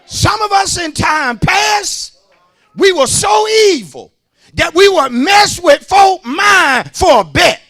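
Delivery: loud, high preaching cry; full-force preaching. Real, not acted. Preacher confessing past evil, loud high cry. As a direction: shout it high and slow to the church.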